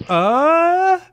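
loud, very high held "uh"; hammed-up cartoon exclamation; startled exclamation. A narrator's reading. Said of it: "Yugi exclaimed".

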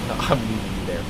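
usual speaking voice

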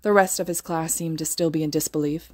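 ordinary delivery

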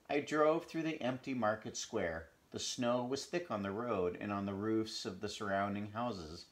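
usual speaking voice

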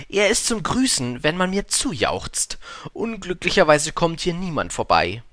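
high, showy conceited voice; vain man. A narrator's reading. Vain man explains his hat to the prince, high and conceited.

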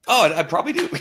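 high, laughing reply; amused. Real, not acted. Answering a viewer who says thinner, amused laughing.

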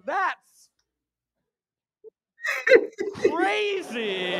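loud, high, laughing disbelief; stunned shout of disbelief; shocked outburst. Retold or imitated. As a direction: cry out that it's crazy. Shocked reaction to date story.

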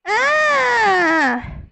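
high, loud startled scream; scream on waking. Acted. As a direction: jolt awake screaming. Wakes from nightmare.

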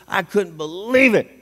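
normal talking voice

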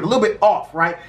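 ordinary talk

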